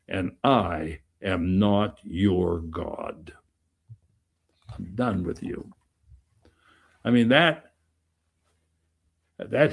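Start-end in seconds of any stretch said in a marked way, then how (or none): 0.1-3.3 And I am not your God, slow and low with weight on I; slow, grave emphasis. Real, not acted. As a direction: say it slowly and gravely. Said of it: "listen to the second phrase".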